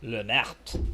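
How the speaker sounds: lightly put-on French accent, low; hint of a comic French accent. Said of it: "like, a Greek accent or a French accent".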